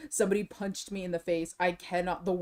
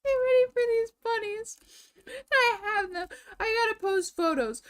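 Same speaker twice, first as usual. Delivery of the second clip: high, bright squealing excitement, a little louder; excited squeal; 13 semitones higher, 4 dB louder than usual. Real, not acted. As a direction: gush over the bunnies. Showing off new pet bunnies.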